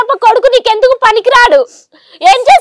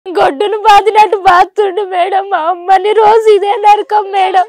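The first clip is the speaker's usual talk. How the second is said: crying. Real, not acted. Daughter crying to host.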